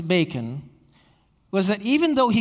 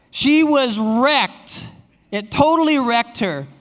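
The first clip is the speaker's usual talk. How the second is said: slow, loud, higher stress on wrecked; dramatic emphasis; 4 semitones higher, 5 dB louder, slower than usual. Real, not acted. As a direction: lean hard on wrecked, stretched out. Dramatic emphasis on wife's reaction.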